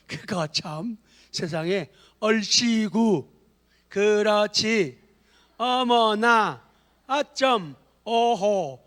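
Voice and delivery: slow read-aloud. Shown as a demonstration. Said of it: "앞에 서가지고 읽어요".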